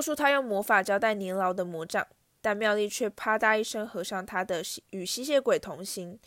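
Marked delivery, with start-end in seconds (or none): none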